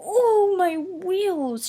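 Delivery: high whispered shiver; high shivering whimper about his wheels. A narrator's reading. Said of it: "shivered Percy".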